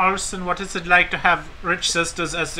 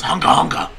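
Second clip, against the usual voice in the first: lightly voiced goofy Joker noise; a hint of a dumb villain grunting; mock Joker grunt. Host mocks a bad Joker with a goofy grunt. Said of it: "a Joker that goes".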